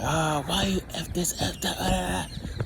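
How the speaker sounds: put-on high, shouting African accent. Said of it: "with, like, an African, um, accent".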